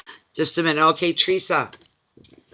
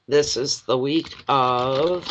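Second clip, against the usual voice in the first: ordinary delivery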